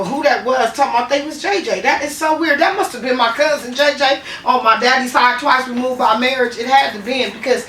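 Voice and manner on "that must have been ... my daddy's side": ordinary delivery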